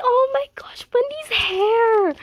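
high, airy, slow gasping delight; breathless gush. Real, not acted. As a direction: breathe it out, awestruck. Overwhelmed fan at photos.

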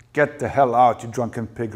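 loud burst on "Get the hell out", then easing; a snarled insult, loud at first; angry snarl. A narrator's reading. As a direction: snarl it as you throw him out. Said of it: "Rachel snarled in his wake".

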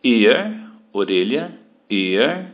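"ear" said clearly, open vowel; pronunciation demo. Shown as a demonstration. Teacher contrasting "ear" with year for students. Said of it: "Year de ear".

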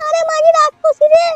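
tearful pleading. Acted. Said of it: "কাঁদছো কেন লাউড়া?".

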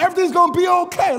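put-on high, loud kid taking charge; confident little kid. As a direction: shout it out like a sure-of-himself child. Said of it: "he's, he's confident".

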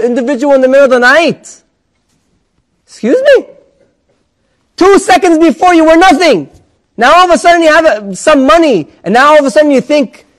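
newly rich man scolding his friend, indignant; haughty indignation. Acted. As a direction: look down on him, outraged. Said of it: "the person changes his tone of voice".

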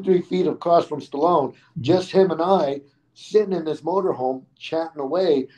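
ordinary talk

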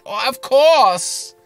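put-on high, drawn-out German accent; loud, slow caricature German agreement; Annika. Girlfriend agreeing to put him on the show, hammed-up German accent. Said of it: "I do an impression of Annika".

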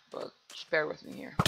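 soft unvoiced whisper; hushed plea to viewers. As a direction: breathe it out like a secret. Pleading with viewers about lag.

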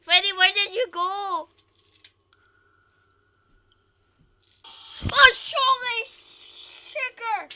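high loud frightened shout; scared horror game streamer. Real, not acted. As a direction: scream at the jump scare.

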